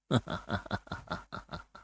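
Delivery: a distant, airy cackle; faint whispered laugh. A narrator's reading. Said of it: "very faint laughter whispers in the wind".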